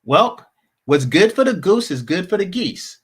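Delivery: ordinary talk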